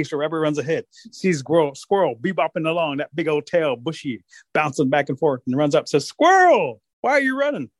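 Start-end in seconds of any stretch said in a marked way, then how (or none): 6.1-7.7 high, loud storybook holler; calling out. Acted. Rabbit runs up to Squirrel, calling out. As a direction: holler the name, then ask high.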